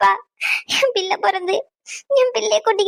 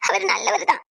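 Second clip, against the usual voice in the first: ordinary talk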